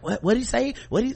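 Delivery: puzzled, hands up in confusion; confused coworker. Retold or imitated. Coworkers on mute asking about the caller, baffled. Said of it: "they'd be like".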